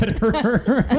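laughing. Acted. Joking about silent boyfriend.

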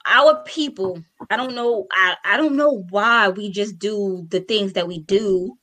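usual speaking voice

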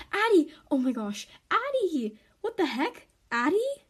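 alarmed calls, high and loud; calling out. A narrator's reading. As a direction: call her name in alarm. Calling out to hurt sister.

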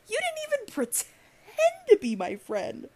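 high-pitched, drawn-out indignant outburst; outraged. Real, not acted. Ranting at a former acquaintance.

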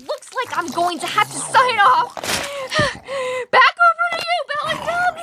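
high panicked yelling under attack; panicked screaming. Acted. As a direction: shriek in terror as you're bitten. Signing off while being attacked.

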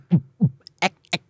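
short vocal drum hits; beatbox a run of different kicks; kick drum imitations. Shown as a demonstration. Podcaster showing many kick samples, imitated drum hits. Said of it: "กระเดื่องอ่ะ จริงๆ มันมี".

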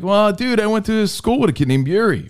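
pitched well up, protesting; high defensive retort. Retold or imitated. Host retelling his excuse for mispronouncing a name, high and defensive. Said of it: "I'm like".

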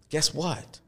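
ordinary talk, nothing marked

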